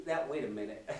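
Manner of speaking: ordinary talk, nothing marked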